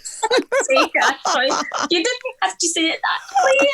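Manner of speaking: high, mortified mock scolding; exasperated. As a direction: cringe at your kid in public. Embarrassed mum scolds child.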